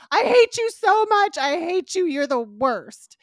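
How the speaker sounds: high loud furious rant at the screen; mock furious yelling. Yelling at Selena on TV, high and furious. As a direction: scream it at the TV.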